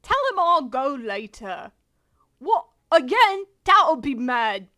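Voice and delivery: loud, hammed-up British accent. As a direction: call it out in a put-on British voice. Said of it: "do the British voice".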